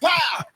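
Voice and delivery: sharp, loud, high pow; loud comic punch sound; loud mock punch. Imitating punch sound in story.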